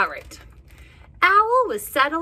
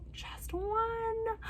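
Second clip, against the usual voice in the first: squeaky little one; 10 semitones higher, 13 dB quieter than usual. Acted.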